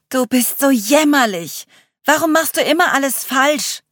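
loud, high-pitched scolding; berating shout; calling out insults. A narrator's reading. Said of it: "rief Charlie".

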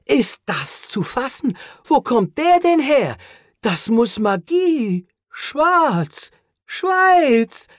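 plötzlich seltsamer fremder Akzent, immer höher und lauter; komischer Akzent. A narrator's reading. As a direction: wie unter einem fremden Einfluss mit komischem Akzent. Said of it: "mit einem komischen Akzent gesprochen". (Cole spricht verwirrt am Spielbrett mit komischem Akzent, immer höher)

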